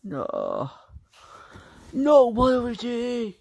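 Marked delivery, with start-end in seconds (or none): 1.9-3.3 high, slow, loud cry of protest; a high drawn-out yell of refusal; loud shout. Acted. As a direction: belt it out, stretched and high. Villain shouting at Waluigi.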